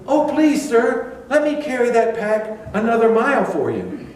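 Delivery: high, sugary-sweet offer; bright mock-humble civilian; cheerful eager servant. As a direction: overeager and polite. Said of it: "you just cheerfully say".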